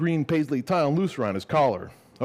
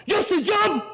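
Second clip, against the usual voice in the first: very high, loud shout; shouting dad; 4 dB louder than usual. Retold or imitated. Dad shouting the repeat at his son, even higher and louder. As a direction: scream the words back even harder.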